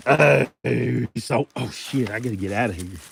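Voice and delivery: normal talking voice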